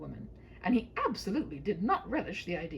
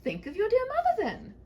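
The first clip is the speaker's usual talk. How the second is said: a hint of a pleading princess, higher; lightly voiced worried princess; 8 dB louder than usual. Princess begging the knight, lightly voiced. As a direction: beg him not to fight.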